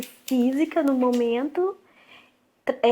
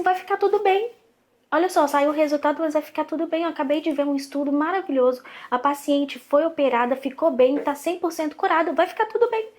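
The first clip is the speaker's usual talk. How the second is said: high, loud, emphatically hopeful; upbeat reassurance; 5 semitones higher, 5 dB louder than usual. Retold or imitated. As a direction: insist brightly that all will be well, as retold. Retold insistent reassurance to a friend.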